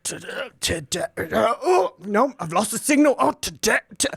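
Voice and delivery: lightly voiced old fella fumbling; a hint of a muddled old man on a bad line; old fella announcer losing the signal, lightly voiced. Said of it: "he'd be like".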